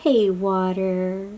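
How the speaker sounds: usual speaking voice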